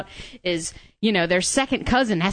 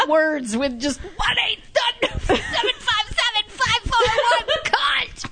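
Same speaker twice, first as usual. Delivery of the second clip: squealing with laughter, very high; laughing through the words; 13 semitones higher, slower than usual. Real, not acted. Joking laughing phone number.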